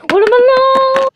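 high loud yell of "Watermelon!"; shouting watermelon. Acted. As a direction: scream "Watermelon!" after the thief. Yelling for character's watermelon.

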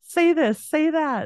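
high-pitched imitation of excited fans; eager egging on. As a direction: mimic people demanding a party trick. Locals egging her on, retold.

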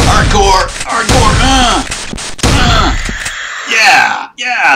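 high, loud victory shouts; loud triumphant yelling; triumphant yells. Real, not acted. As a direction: roar it out as you win. Triumphant shooting Slender.

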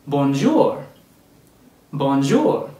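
amateur attempt at a French accent; French greeting in a clumsy non-native accent; terrible French accent. Shown as a demonstration. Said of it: "I apologize for my terrible accent".

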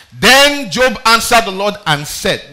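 drawn out, loud pulpit announcement; slow loud proclamation. Acted. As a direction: announce it with weight. Announcing Job's answer.

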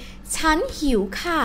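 soft, polite Thai phrase. Shown as a demonstration. Teacher showing students how to say it politely, polite. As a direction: say it softly and courteously. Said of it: "say it politely".

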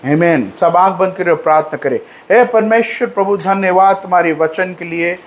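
normal talking voice

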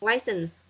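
License said LYE-sense, long i vowel; correct pronunciation. Shown as a demonstration. Teacher models correct License for class, LYE-sense. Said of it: "Từ này đọc là license nhá".

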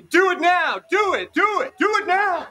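high, loud mimicked yelling; shrieked impression of urgent commands; imitated screaming. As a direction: re-enact a friend screaming orders at you. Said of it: "Joy kept screaming at me".

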